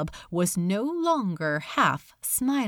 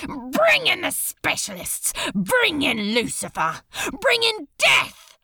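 defiant tirade; 6 semitones higher than usual. Acted. Crowley daring hell to send its worst, defiant shouts. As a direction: fling the challenge at him. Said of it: "the words just kept pouring from him".

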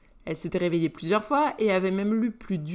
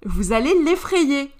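remarque posée; 6 semitones higher, 7 dB louder, slower than usual. Acted. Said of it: "lança la voix de Merlin".

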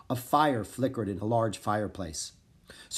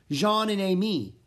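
plain reading voice

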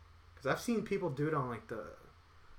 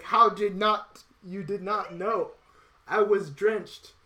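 loud, high, slow mock indignation; hammed-up outrage at the sweaty neighbour; indignant outrage; 6 semitones higher, 8 dB louder, slower than usual. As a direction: drag each word out in disbelief. Retorts to sweaty Gary indignantly.

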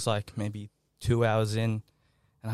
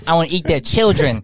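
Mike Tyson impression; 6 semitones higher, 9 dB louder than usual. Quoting Tyson, mocking.

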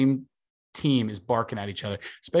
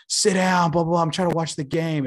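higher, louder heckler yelling down at them; mock yell from the stands; 6 semitones higher, 3 dB louder than usual. Santa-suited fan yelling at them to sit down, higher mock yell. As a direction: bark it down from rows above like an annoyed fan. Fan yells from seats above.

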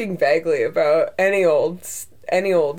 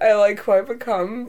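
ordinary talk

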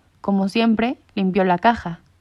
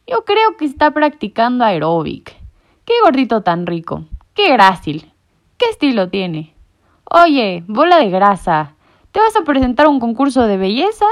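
teasing, loud sing-song jeers; mocking tomcat; 4 semitones higher, 7 dB louder than usual. A narrator's reading. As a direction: taunt him like a bully. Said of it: "maulló el otro". (gato burlón que se mofa)

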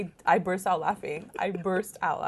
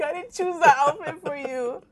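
higher imitated voice of Veronica; mock Veronica; 7 semitones higher than usual. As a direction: mimic her protest higher. Veronica quoted in put-on voice.

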